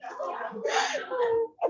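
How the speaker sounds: high, soft breathy giggle; high soft laugh. Real, not acted. Preacher still giggling at his Selangor joke, high soft laugh.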